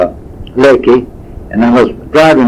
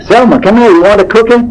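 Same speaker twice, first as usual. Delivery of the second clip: imitating the waitress calling her coworker, pitched up; retold yell; 9 semitones higher than usual. Waitress calling coworker over for a cookie, mock yell. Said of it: "And she yelled back".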